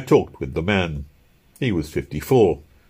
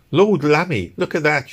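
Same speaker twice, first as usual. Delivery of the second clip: put-on higher exclamation of a London girl; exclaiming; 8 semitones higher, 4 dB louder than usual. A narrator's reading. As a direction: exclaim at a sudden sight. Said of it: "she exclaimed".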